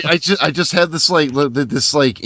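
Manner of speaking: normal talking voice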